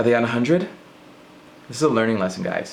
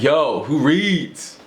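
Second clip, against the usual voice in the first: slow drawn call-out; 4 semitones higher, much slower than usual. Retold or imitated. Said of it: "I'm just like".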